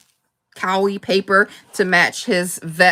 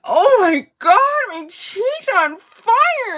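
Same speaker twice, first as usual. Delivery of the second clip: high, slow complaining Barbie voice; Barbie massaging sore cheeks; imitating Barbie; 13 semitones higher, slower than usual. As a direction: stretch out fire like an aching doll. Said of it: "she's like".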